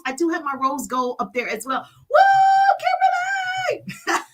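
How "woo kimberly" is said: very high, loud whooping shout; hollering a name. Real, not acted. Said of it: "hollering people names out".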